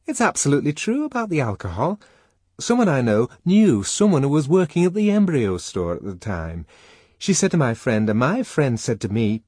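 excited high-pitched party chatter; gossiping Fanny. A narrator's reading.

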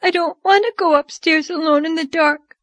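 put-on high-pitched, frightened child's plea; tearful sobbing. A narrator's reading. A child begging not to go upstairs alone, sobbing. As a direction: plead tearfully in a small girl's high voice. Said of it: "she sobbed".

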